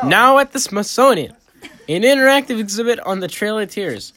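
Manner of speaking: put-on loud laughing Boston accent. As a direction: belt the card out in a Boston accent. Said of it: "they're doing it in their Boston accent".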